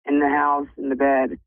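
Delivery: usual speaking voice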